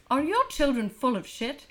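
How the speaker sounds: put-on lively old-timey announcer, laughing through; 1930s radio ad. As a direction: sell it like a 1930s radio commercial. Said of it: "make up my own a nineteen thirties commercial".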